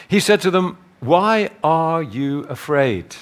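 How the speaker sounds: measured, solemn Bible reading; scripture reading. Acted. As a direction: read the passage like a preacher at the lectern. Reads the gospel aloud.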